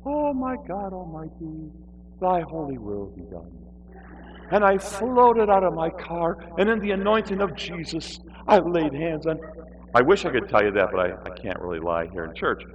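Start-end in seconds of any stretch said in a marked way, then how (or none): 0.1-3.5 mock holy. Mock holy prayer in sermon story. 4.5-9.4 mock holy. Fantasy version told mock grandly.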